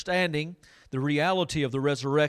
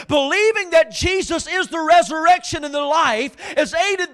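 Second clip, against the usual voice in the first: loud, driving sermon delivery; preaching at full force; 10 semitones higher, 9 dB louder than usual. Acted. Pastor preaching to his congregation, loud and driving. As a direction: preach it hard to the room.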